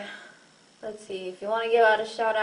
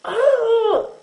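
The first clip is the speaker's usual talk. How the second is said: burp-like yelp; 12 semitones higher, 9 dB louder than usual. Real, not acted. Burp bursts out.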